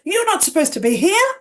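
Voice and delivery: high, loud, quick rebuke; angry retelling. Retold or imitated. Woman confronting the unexpected arrival, angry. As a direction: scold an intruder, voice jumping up. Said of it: "She's quite angry".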